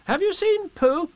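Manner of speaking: put-on very high boy's voice; Christopher Robin asking. A narrator's reading. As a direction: ask slowly as an anxious little boy. Boy asks after his friend.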